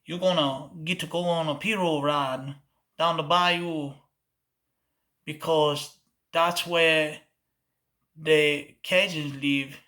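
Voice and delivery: slurry bayou Cajun accent; slurred Cajun accent. Shown as a demonstration. Crossing down to the bayou, slurred Cajun accent. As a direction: slur it into Louisiana Cajun speech. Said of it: "add a little slur and an accent".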